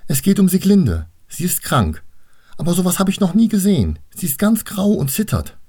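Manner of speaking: put-on high, urgent child's voice; worried little girl. Annabel tells her teacher Sieglinde is sick, high and worried.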